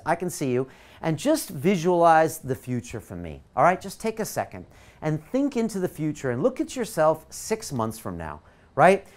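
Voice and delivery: usual speaking voice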